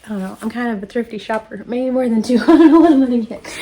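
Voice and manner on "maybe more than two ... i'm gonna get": much louder, fast, laughing and excited; laughing excitement. Real, not acted. Planning to spend a friend's gift card, laughing loudly.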